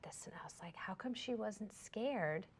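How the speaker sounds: fast hushed whisper, breathy with wonder. Retold or imitated. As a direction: hush it to a whisper as if thinking aloud.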